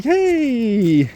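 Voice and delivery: high, drawn-out mock "hey"; imitating patronizing supervisor. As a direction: greet them in a high, condescending sing-out. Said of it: "kinda like a, "Hey, big fella".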